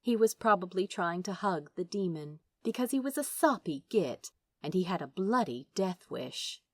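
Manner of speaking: ordinary delivery, nothing marked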